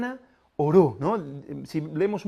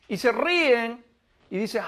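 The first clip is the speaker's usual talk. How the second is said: ordinary talk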